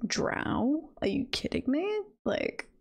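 normal talking voice

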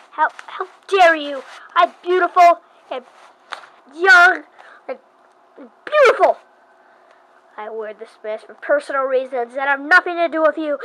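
loud, high, offended outburst; offended teen idol storming off; indignant. Acted. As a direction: huff like a vain insulted idol.

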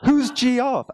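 incredulous high-pitched who; confused customer. Retold or imitated. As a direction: mimic a puzzled Brit who doesn't know that name. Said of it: "And he goes".

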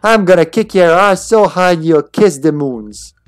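put-on loud, cocky Australian girl; fight challenge. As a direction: square up and taunt her.

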